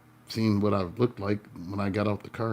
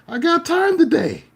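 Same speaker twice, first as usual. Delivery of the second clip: I got time today yelled much higher, louder and slow; screamed dare; 9 dB louder than usual. Retold or imitated. Out the car window at the driver, a slow yelled dare. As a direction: bellow time today at him. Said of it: "I screamed out the window".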